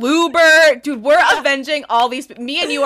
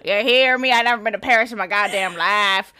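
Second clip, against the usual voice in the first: mock Southern twang; Southern accent; 4 semitones lower than usual. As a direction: play a Texan cowgirl. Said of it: "I can do a pretty good one".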